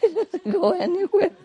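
laughing. Real, not acted. Laughing repeat of punchline.

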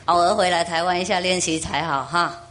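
normal talking voice

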